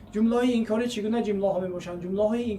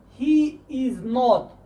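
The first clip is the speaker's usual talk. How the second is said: slow full form; 3 semitones higher, 3 dB louder, much slower than usual. Shown as a demonstration. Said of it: "full form before short forms".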